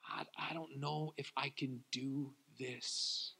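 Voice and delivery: a small voice asking God for someone else; quiet prayer. Retold or imitated. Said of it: "I'd say".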